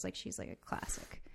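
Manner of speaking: hushed, breathy praise; whisper. Real, not acted. Actor whispers praise of her costar, hushed. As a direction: whisper it like a secret compliment. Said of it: "whispers praise".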